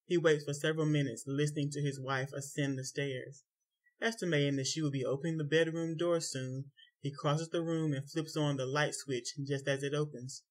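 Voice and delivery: plain reading voice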